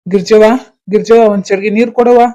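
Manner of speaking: loud call for someone in the house; hailing someone by name, loudly. Acted. Father calls daughter for water.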